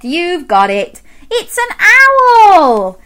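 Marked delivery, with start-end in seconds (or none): 1.3-3.0 loud, high, drawn-out announcement; excited reveal. Real, not acted. Presenter revealing the answer to children, loud excitement. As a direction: swoop high on OWL and fall.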